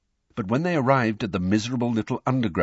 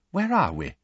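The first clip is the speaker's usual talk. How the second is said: blankly puzzled, pitched higher; 8 semitones higher than usual. A narrator's reading. Mr Weasley lost on a London street, blank. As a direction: ask it higher, at a loss. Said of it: "said Mister Weasley blankly".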